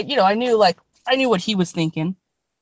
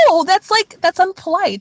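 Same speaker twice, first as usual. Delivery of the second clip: prim; 8 semitones higher than usual. Real, not acted. Prim objection to rudeness.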